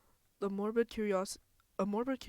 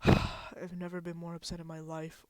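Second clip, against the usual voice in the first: ordinary delivery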